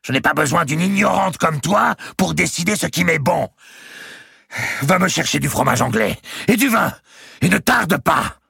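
high, loud, imperious tirade; furious ordering. A narrator's reading. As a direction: order your servant about at a scream. Countess rants and orders food.

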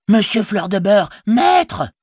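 very high, startled call to the master; cry of surprise. A narrator's reading. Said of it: "il lâcha un cri de surprise". (cri de surprise très aigu)

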